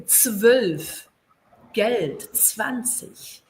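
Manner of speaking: "zwölf, Geld, zwanzig" said as isolated words with crisp consonants; German consonant clusters. Shown as a demonstration. Teacher modelling "zwölf, Geld, zwanzig" for students, careful consonant clusters. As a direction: sound out the ts-v start of "zwölf" and "zwanzig". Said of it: "todas as junções consonantais".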